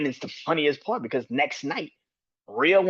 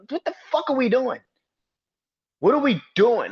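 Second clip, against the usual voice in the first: exasperated outburst; 10 semitones higher, 6 dB louder than usual. Real, not acted. Ranting about confusing storyline.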